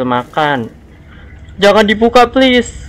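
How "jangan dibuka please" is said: scared pleading. Real, not acted. Begging game not to open door.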